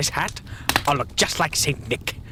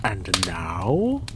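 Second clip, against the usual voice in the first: low sly Grinch voice; grinning Grinch; 8 semitones lower than usual. Acted. Grinch eyeing the tree, low grinning villain. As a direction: savor the question like a thief. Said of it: "grinned the Grinch".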